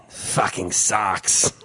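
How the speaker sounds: low, drawn-out Russian verdict; deep Russian accent, each word stretched; emphatic Russian accent. Retold or imitated. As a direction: dismiss it slowly like a Russian guy. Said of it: "I'm doing the Russian guy".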